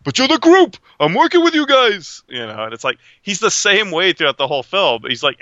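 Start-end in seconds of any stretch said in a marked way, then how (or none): 0.0-2.2 high, loud, pleading; Keitel impression. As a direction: plead it like a frantic manager. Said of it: "He goes".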